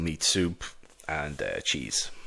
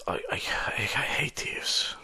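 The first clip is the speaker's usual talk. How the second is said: whispered grudge; 5 semitones higher than usual. Acted. Lord Emerald confiding his hatred of thieves, whispered. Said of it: "he says".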